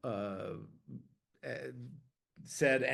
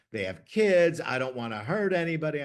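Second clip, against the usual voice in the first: higher, louder, faster pleading; 4 semitones higher, 5 dB louder than usual. Retold or imitated. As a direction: push it out, worried and insistent. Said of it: "he was emphatic".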